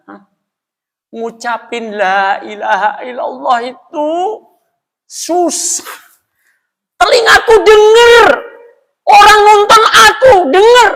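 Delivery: high, loud, tear-choked cry; tearful confession. Retold or imitated. Brother-in-law tells the speaker of his struggle, crying. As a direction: pour it out through sobs. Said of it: "ipar saya nangis".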